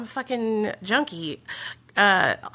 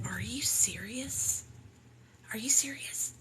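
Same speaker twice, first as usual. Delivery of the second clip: stunned, hushed disbelief; shocked whisper; 2 semitones higher, 8 dB quieter than usual. Retold or imitated. Narrator whispering back at her sponsor in shocked disbelief.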